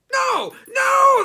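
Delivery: very high, very loud scream; goblin scream. Acted. Trapped goblin screaming at Herschel, very high and loud. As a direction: scream it in despair. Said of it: "the goblin screamed".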